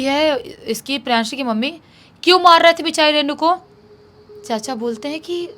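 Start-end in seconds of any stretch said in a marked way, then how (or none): 2.2-3.6 louder, higher-pitched demand; retold indignant questioning; acted accusation. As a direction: confront him over the beating. Acted confrontation of the uncle.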